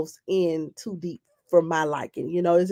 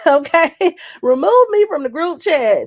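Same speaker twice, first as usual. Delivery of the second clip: laughing; 7 semitones higher, 6 dB louder than usual. Real, not acted. Laughing and repeating the line.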